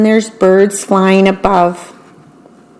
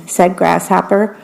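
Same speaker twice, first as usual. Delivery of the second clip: ordinary delivery, nothing marked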